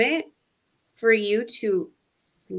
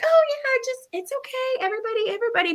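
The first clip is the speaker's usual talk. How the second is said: much higher, bright mock-breezy brush-off; fake cheerful front; much faster than usual. Mom hiding her overload behind a chipper fake okay. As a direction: paper over the overload with a sing-song "it's fine". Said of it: "but then externally".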